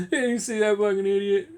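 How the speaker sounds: laughing, sneering clerk voice; imagined postal clerk laughing at the customer; mocking postal worker. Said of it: "they'll go".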